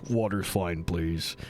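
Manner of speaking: low, softening cartoon dad; dad, lightly voiced. Dad turning down a drink from the stewardess, low voice. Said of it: "And he goes".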